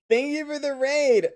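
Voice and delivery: high sweet thank-you voice; cute anime streamer. Acted.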